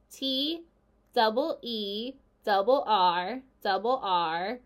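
slow, drawn-out gander spelling letter by letter. Acted. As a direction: spell it out painfully slowly like a proud gander.

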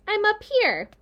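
a tiny spider greeting from above; small spider voice. A narrator's reading. A baby spider greets Wilbur from the doorway, small and high. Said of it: "when he heard a small voice".

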